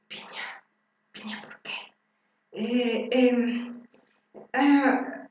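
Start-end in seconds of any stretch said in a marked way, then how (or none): 0.1-1.7 a bewildered patient murmuring under breath; soft unvoiced puzzled whisper; confused whisper. Shown as a demonstration.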